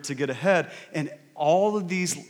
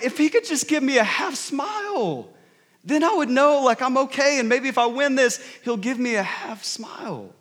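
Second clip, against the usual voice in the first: lightly voiced contestant, pitched higher and near tears; tearful contestant; 8 semitones higher than usual. Baker on TV, wishing for her dad's smile, mild tearful pleading. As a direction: play the hurting baker longing for her dad's approval, a touch choked. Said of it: "And she's just like".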